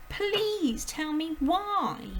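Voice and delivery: put-on high, loud, slow anguished child; high distressed little girl; breathless girl's cry. A narrator's reading. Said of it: "Matilda cried".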